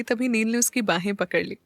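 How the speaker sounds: ordinary delivery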